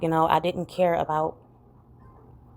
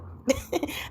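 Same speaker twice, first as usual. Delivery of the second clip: a quick, high, unvoiced laugh of embarrassment; high breathy laugh; 10 semitones higher, 5 dB quieter than usual. Real, not acted. Laughs at the nickname.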